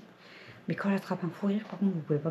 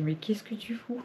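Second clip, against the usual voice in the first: hushed mock whisper of disbelief; whispered what-are-you-doing; retold whisper; 3 semitones higher, 5 dB quieter than usual. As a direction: breathe it out like a stunned aside. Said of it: "je dis".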